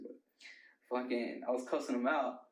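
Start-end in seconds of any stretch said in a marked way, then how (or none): none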